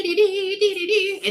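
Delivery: high imitated ESPN alert tones; phone alert. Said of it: "when my phone goes".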